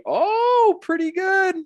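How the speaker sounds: high, loud strained oh; strained high exclamation. Real, not acted. Surfer showing how far his arm now straightens, strained high cry.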